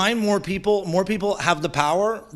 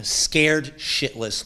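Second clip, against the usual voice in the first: ordinary talk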